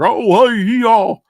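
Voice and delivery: high, loud Southern greeting; mock Southern accent. Imitating what people hear from a Southerner, mock Southern accent. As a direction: exaggerate the Southern accent on a big hello. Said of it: "they hear a Southern accent".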